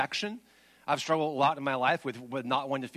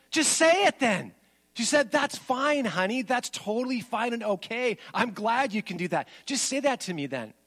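imitating his wife; 6 semitones higher than usual. Wife voiced in retold argument.

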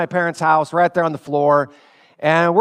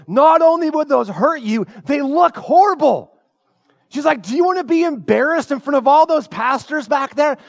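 mom shaming; 8 semitones higher, 4 dB louder than usual. Retold or imitated. Mom shaming son's shoes.

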